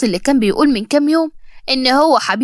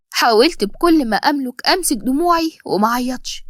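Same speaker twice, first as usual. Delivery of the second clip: ordinary delivery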